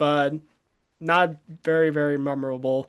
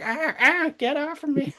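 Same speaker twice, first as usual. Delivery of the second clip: high, whimpering little creature; whiny baby monster voice; 10 semitones higher than usual. Retold or imitated. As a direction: squeal it like a bullied baby. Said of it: "and he's like".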